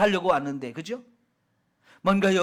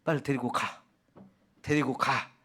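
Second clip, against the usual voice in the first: frightened dismissal; 6 semitones lower, 4 dB quieter than usual. Acted. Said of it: "벌벌 떨면서".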